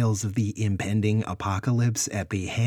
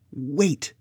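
urgent higher shout; 8 semitones higher, 5 dB louder than usual. Acted. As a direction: cut in with a sharp shout. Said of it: "Luke shouted".

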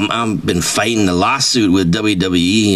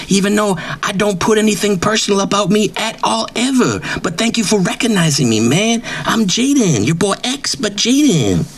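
pitched-up, swaggering braggart voice; cocky wrestler persona; 11 semitones higher than usual. Acted. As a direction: brag like a washed-up wrestling star who thinks he's famous. Comedic self-important wrestler persona.

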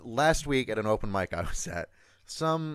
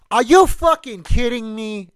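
much higher, louder Boston townie yell; 11 semitones higher, 11 dB louder than usual. Retold or imitated. Townies in the corner heckling the singer, a loud Boston yell. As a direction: bellow it like an outraged Boston local. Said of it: "in that voice".

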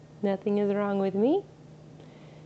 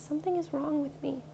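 inner voice pitched higher, fretful and small; worried little voice; 7 semitones higher than usual. Acted. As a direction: voice the anxious inner part. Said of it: "The little voice that says".

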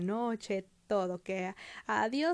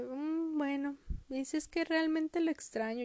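lightly voiced meek child, higher; a small, hesitant pitched-up reply; meek Heidi; 6 semitones higher than usual. Heidi admits she misses grandpa, lightly acted meekness. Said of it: "se queda así de".

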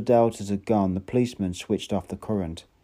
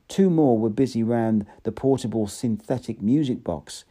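ordinary delivery, nothing marked

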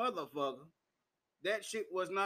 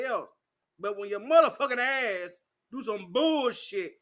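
angry; 5 semitones higher, 6 dB louder than usual. Retold or imitated. Telling off the clerk, retold.

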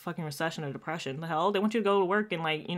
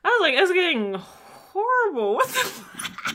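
incredulous; 11 semitones higher, 4 dB louder, slower than usual. Retold or imitated. Exasperated retold reaction.

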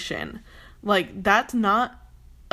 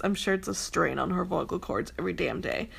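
ordinary talk

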